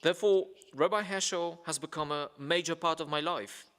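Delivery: ordinary talk, nothing marked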